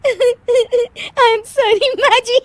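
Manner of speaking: high, slow apology dissolving into giggles; laughing apology. Acted. Daughter-in-law apologises to her mother-in-law, laughing helplessly. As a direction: apologise but can't stop laughing. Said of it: "अरे फिर से हंस रही है".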